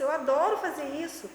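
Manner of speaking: ordinary talk, nothing marked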